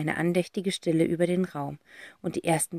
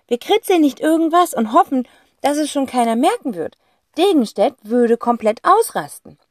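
put-on eager boy voice; 7 semitones higher, 6 dB louder than usual. Boy explaining the plan to his sister, eager high voice. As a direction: play the boy with a bright idea, higher. Zack figure voice.

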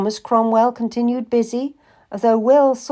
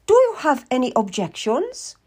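high-pitched, challenging question; imperious; 6 semitones higher than usual. Acted. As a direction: ask it as a grand lady expecting obedience. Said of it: "Lady Willoughby said imperiously".

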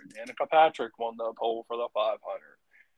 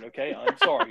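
apologizing through a laugh; high laughing apology; 13 semitones higher than usual. Real, not acted.

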